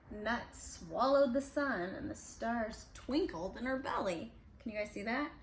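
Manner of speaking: ordinary delivery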